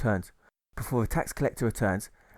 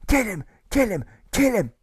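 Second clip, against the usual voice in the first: high, loud chant of demands; shrill repeated yells for execution; frenzied shouts; 12 semitones higher, 7 dB louder than usual. Acted. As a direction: hammer out each demand louder. Provost marshal demands death.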